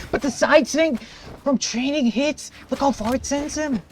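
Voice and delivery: high, loud hype play-by-play; esports caster. Real, not acted. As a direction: build to a roaring clutch call. Caster calls the final clutch.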